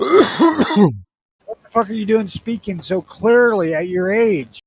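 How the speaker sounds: high, loud, slow mock shock; mock outrage. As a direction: bellow in fake disbelief. Mock outrage at baby daughter.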